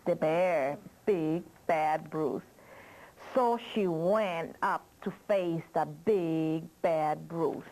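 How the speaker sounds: ordinary delivery, nothing marked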